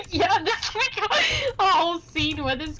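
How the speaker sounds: high, giggly retelling; laughing while talking. Real, not acted. Cracking up over acted breakdown.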